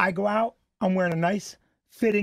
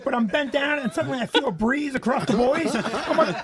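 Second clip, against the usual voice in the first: ordinary talk, nothing marked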